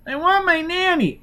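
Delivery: put-on very high, pleading little-girl wail; frightened child. A narrator's reading. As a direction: cry for Nanny like a terrified small child. Child cries for Nanny.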